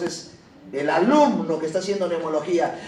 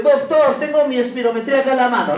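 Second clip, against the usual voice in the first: higher, louder eager patient voice; imagined patient; 4 semitones higher, 6 dB louder, faster than usual. Pretend. Teacher voicing a patient proudly presenting a test, eager and raised. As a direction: play the patient showing off his test.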